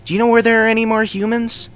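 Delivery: high, loud pony voice, eager and worried; anxious question. A narrator's reading. Lyra asks Luna about humans, high and anxious. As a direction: blurt it out high and urgent. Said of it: "Lyra anxiously asks".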